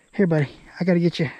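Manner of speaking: ordinary talk, nothing marked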